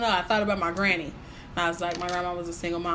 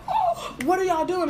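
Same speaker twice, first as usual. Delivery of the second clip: high, surprised quote, much higher; startled; 12 semitones higher than usual. Retold or imitated. To his whole family on FaceTime, high startled question. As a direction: caught off guard.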